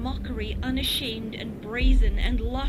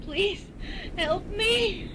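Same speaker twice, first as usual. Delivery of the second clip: higher, pleading call over the waves; desperate plea to the men; calling for help; 12 semitones higher, much slower than usual. A narrator's reading. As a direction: cry out for help. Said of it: "she called out above the roar of the waves".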